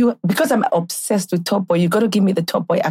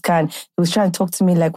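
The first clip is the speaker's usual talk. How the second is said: usual speaking voice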